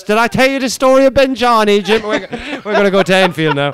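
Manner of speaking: imitating Harry Redknapp. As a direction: spin it like a chatty old manager. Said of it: "I love Cockney storytellers".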